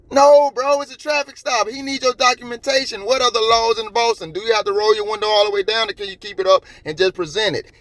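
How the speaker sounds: high-pitched, insistent rant; exasperated scolding. Real, not acted. Vlogger scolding a driver about a traffic stop, exasperated rant.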